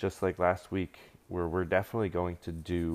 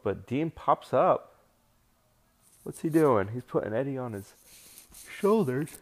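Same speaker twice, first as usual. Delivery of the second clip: excited; 6 semitones higher, 5 dB louder than usual. Real, not acted. Surprise move call.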